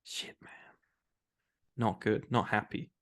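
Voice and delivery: very soft, nearly whispered mock dismay; hushed reaction. Host retelling their reaction to the breakup twist, hushed. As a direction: drop to a low, hushed murmur.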